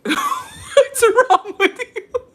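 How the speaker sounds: high, slow words through a laugh. Retold or imitated. Friend cracking up while asking, laughing. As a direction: Jacobo laughing at her soaked clothes. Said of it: "Jacobo was like".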